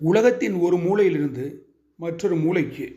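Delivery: normal talking voice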